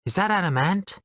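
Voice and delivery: a gentle, high-voiced caller on the line; soft high voice. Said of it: "A soft, high-pitched voice".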